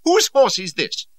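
high, loud, slow demand. A narrator's reading. Cardigan demands whose horse this is in high temper. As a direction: bark the question. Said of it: "he demanded".

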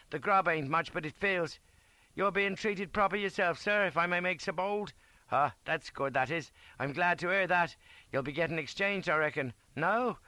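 high, lively ranker's voice; chatty sergeant. A narrator's reading. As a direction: talk up eagerly to an admired officer. Ryan chats eagerly with Flashman.

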